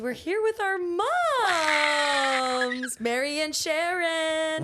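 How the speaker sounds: excited announcement. Real, not acted. Announcing guests.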